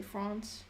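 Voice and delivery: FRANCE with a long open ah; France with an open British vowel; British pronunciation. Shown as a demonstration. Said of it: "in the British way".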